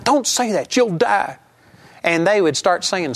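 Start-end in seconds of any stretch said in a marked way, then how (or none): 0.0-1.4 higher, mock-panicked hushing; alarmed warning. Zealot hushing a friend's careless words, in mock alarm. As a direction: imitate a zealous believer frantically stopping someone. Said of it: "jump up and put their hand over your mouth".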